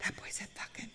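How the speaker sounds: put-on whispered 'That boy said fucking' with no voice at all; kids whispering. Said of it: "everyone started whispering".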